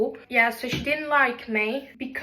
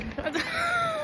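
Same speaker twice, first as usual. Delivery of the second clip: sudden high shriek of a laugh; 5 dB quieter than usual. Real, not acted. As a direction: burst into a squealing laugh. Laughs mid outro.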